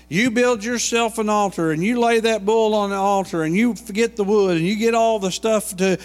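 high, loud and commanding, laying out the terms; Elijah's challenge. Acted. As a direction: call the prophets out boldly. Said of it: "Elijah says".

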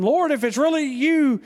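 loud, high call to Jesus across the water; a fast, eager call over the waves. A narrator's reading. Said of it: "Peter called to him".

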